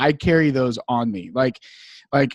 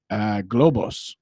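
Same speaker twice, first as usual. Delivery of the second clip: tentative answer; 4 semitones lower, slower than usual. Retold or imitated. Acts own hesitant answer to guard.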